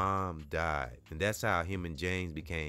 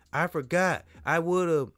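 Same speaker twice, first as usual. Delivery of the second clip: stammering fear; 10 semitones higher, 6 dB louder than usual. Acted. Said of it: "stuttered Alfred".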